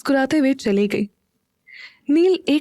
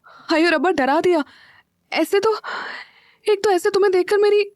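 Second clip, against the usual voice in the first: high, rushing, flustered gasp of fright; startled by Neel suddenly behind her, high and rushed; 9 semitones higher than usual. A narrator's reading. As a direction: jump out of your skin and babble higher and faster. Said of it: "Meera घबराते हुए बोली".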